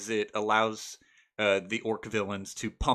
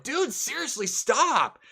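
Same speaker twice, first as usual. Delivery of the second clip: mock exasperated plea; 14 semitones higher, 3 dB louder, slower than usual. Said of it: "the other three players are trying to get them to stop".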